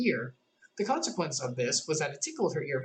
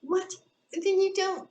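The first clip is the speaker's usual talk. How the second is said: much higher, tiny insect voice; tiny gnat voice; 4 dB louder, slower than usual. A narrator's reading. Gnat whispering a joke into Alice's ear, tiny and high. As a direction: squeak it close in her ear. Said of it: "the little voice began".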